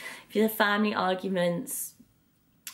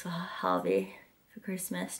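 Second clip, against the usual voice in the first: secretive whisper, voice fully off; 8 dB quieter than usual. Real, not acted. Secret about boyfriend's gift.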